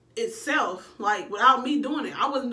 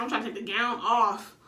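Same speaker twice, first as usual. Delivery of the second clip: ordinary talk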